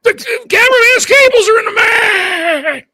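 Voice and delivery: high panicked shout; screaming. Retold or imitated. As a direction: shriek it like a panicked announcer. Mimicking Shivani's scream.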